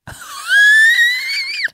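high-pitched squealing laughter; shrieking laugh. Real, not acted. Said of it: "I just find that so funny".

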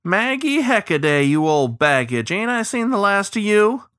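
high, hearty mock insult; joshing old riverman. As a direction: hail an old friend with a high-voiced jibe. Joshing greeting to old friend.

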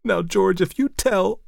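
very high, tearful warning; sobbing plea. A narrator's reading. Upset sister begs brother to keep secret, sobbing. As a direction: plead as if near tears, pitched high. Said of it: "sobbed Elsie".

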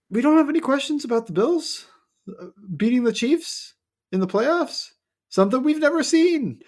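high, mocking sarcastic tone. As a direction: sell it with fake wonder. Mocking the Bills hype.

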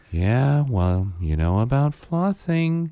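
slow, high-pitched condescending lecture; mock a patronising dental hygienist. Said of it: "the most condescending".

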